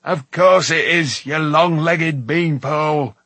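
slow, emphatic, loud insult; irritable dwarf's retort; snapping. A narrator's reading. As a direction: snap back at a foolish friend. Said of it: "snapped Dolly's voice".

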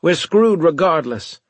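loud and high, despairing; exasperated outburst. A narrator's reading. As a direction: burst out that all is lost. Desperate argument after refusal.

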